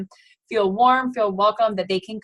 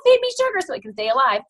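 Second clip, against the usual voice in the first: high, pleading gut-yeast voice; whiny candida voice; 9 semitones higher, 4 dB louder, faster than usual. Pretend. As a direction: beg for sugar like a dying yeast. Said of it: "candida doesn't wanna die. So it's like".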